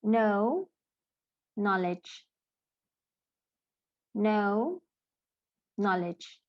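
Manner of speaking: gliding "oh" in "No", short open o in "Knowledge"; vowel contrast. Shown as a demonstration. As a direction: alternate "No" and "Knowledge" as separate model words, vowels contrasted. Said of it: "the sound changes to".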